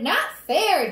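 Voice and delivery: put-on loud, high kid's holler; hollered protest. Daniel protesting the bicycle punishment, hollering. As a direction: holler it like a wronged child. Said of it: "Daniel hollered".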